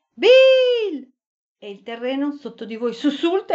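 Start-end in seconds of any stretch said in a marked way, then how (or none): 0.1-1.1 loud high yell of a name; shouting. A narrator's reading. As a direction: shout the name across the dark. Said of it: "alzate la voce tutti e due e urlate".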